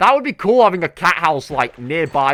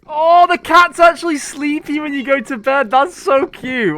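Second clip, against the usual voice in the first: gushing; 10 semitones higher, 6 dB louder than usual. Real, not acted. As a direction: coo over the sleeping cat. Watching cat sleep.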